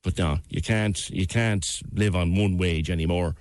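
ordinary talk, nothing marked

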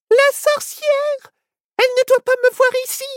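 tiny panicked critter voice; alarmed squirrel. Acted. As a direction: squeal the warning, then hurry. Squirrel panics as witch approaches.

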